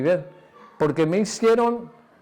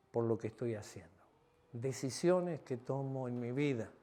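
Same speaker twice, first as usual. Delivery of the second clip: soft, much quieter sermon close; hushed preaching; 2 semitones lower, 11 dB quieter than usual. Acted. Pastor closing the sermon to his church, hushed and intimate. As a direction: draw the congregation in softly.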